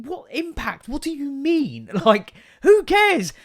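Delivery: high-pitched incredulous rant, laughing; exasperated rant. Retold or imitated. Reading the donor letter, a high exasperated rant. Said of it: "And I was thinking".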